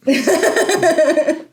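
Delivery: high, slow, laughing "spoiler alert". Real, not acted. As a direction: laugh through a mock warning.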